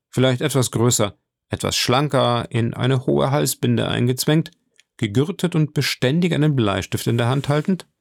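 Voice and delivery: plain reading voice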